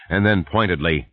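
plain reading voice

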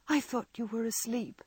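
usual speaking voice

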